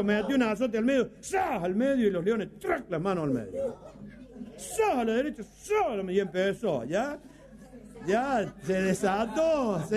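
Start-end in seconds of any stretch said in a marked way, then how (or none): 4.6-5.9 sharp high "¡Zas!" whip crack; vocal whip crack; mock whip crack. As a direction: snap the whip with your voice. Whip crack sound effect.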